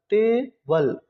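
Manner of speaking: Table in two separate beats, टे, बल; teacher breaking table into syllables; split into syllables. Shown as a demonstration. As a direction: pause between टे and बल. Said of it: "इस word में दो syllable".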